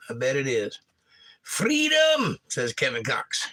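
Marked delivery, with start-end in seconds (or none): 1.5-2.4 high, loud mock war cry of freedom; quoting Braveheart to a Scottish viewer, hammed-up rallying yell; Braveheart battle cry. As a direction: bellow it like a hammed-up movie hero. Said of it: "I've seen Braveheart fifty times".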